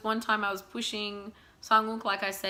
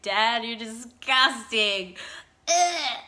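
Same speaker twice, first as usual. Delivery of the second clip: put-on slow, drawn-out disgusted child with a laughing ugh; mock disgust; 6 dB louder, much slower than usual. Child telling dad a nappy change is gross, drawn-out mock disgust. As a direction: play a grossed-out kid.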